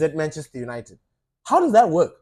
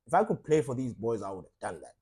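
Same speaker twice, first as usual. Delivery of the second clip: weary manager, quiet; 9 semitones lower, 9 dB quieter than usual. Retold or imitated. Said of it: "he would be like".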